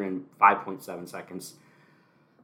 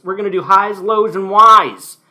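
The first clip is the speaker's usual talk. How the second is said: louder, higher announcer call of highs, lows, and whys; announcer intro; 8 semitones higher, 13 dB louder than usual. Acted. Host introducing his segments, a loud announcer call. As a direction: boom it out like a show host. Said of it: "introduce the segments".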